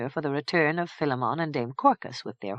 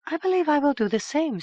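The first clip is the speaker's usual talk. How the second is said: put-on young woman voice; 11 semitones higher than usual. Madook speaks.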